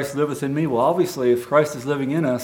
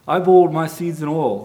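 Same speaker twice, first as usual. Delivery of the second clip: emphatic reveal; 4 semitones higher, 6 dB louder than usual. Acted. King's revelation.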